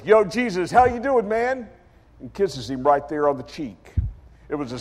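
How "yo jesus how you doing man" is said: loud, higher, over-friendly hail; brash Judas greeting. Retold or imitated. Judas busting through to Jesus, breezy loud greeting. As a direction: greet him like a street buddy. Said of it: "comes busting through the crowd and he goes".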